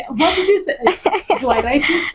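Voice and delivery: mock-puzzled question, half laughing; retold bewilderment. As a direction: act out being lost, breaking into laughter. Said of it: "And I'm like, I said".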